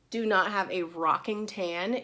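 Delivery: ordinary delivery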